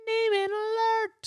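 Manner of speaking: high, slow siren-like call; alarm sing-song. Acted. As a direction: chant it like an alarm.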